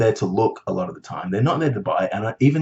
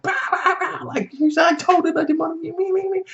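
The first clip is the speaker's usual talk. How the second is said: very high, squeaky mock rant; mock tantrum. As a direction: ham up a childish tantrum. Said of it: "Just went off".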